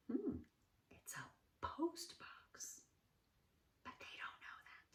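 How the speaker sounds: conspiratorial whisper aside. Real, not acted. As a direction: lean in and whisper. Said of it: "secret aside to children".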